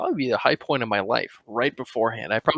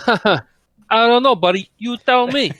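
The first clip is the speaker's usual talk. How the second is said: put-on Italian accent; 11 semitones higher, 7 dB louder than usual. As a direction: ham up a cartoon Italian accent. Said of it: "how good is your stereotypical Italian accent".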